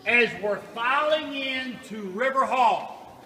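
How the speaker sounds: loud call echoing off cave walls. Real, not acted. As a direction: call out slowly to a group in a cave hall. Guide calls to group in cave.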